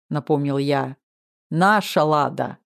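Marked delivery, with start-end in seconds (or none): 1.5-2.6 chorused bark. A narrator's reading. Two coaches correct their pupil together, mild chorused bark. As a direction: draw out each word to correct him. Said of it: "хором рявкнули два моих тренера".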